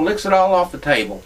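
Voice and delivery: normal talking voice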